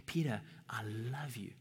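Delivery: voiceless, tender whisper. Acted. Jesus speaking to Peter, in a hushed whisper. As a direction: breathe the name like a secret. Jesus' words repeated for emphasis.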